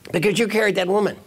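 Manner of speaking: high-pitched, indignant retort; hammed-up accusation; angry monk. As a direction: fire back in mock outrage. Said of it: "his colleague is still angry".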